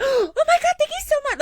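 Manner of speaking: high, loud squealing gratitude; gushing thanks. Retold or imitated. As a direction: gush like a fan. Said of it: "not expecting everybody to be like".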